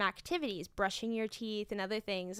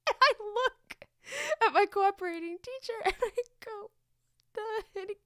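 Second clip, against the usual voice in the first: higher and louder, laughing through the words; 10 semitones higher, 4 dB louder than usual. Real, not acted. Teacher laughing while recalling looking at her colleague. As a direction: giggle while telling it.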